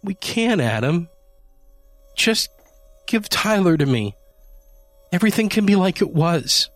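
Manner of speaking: high, urgent pleading voice. A narrator's reading. Wife pleads with husband to give her the kitten, high and urgent. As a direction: coax him into handing the cat over. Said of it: "she pleaded with open arms".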